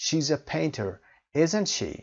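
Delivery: "isn't she" rises high like a real question; rising tag. Shown as a demonstration. Teacher checking a fact with a rising tag. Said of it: "said with rising intonation".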